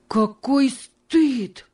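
slow, high exclaim; воскликнул. A narrator's reading. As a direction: cry it out slowly. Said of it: "воскликнул он". (медленное громкое восклицание)

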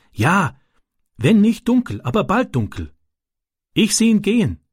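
put-on high-pitched, halting boy in broken German; Ram, a foreign boy. A narrator's reading. Foreign boy telling his friend about the gang, high and halting.

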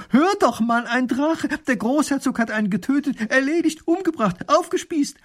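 wife bursting with the dragon news, rising and loud; overexcited. Acted. Wife breathlessly tells husband.

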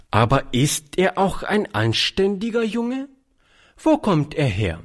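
mildly stern spinster. A narrator's reading. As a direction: question him like a strict governess. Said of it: "fragte Charlotte streng".

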